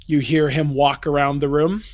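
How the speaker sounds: normal talking voice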